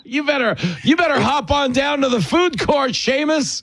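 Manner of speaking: high mocking producer scolding; bossy producer voice. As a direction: play a pushy TV producer.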